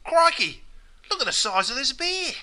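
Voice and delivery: high-pitched astonished Aussie; surprised exclamation. Acted. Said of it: "it's used as an expression of surprise".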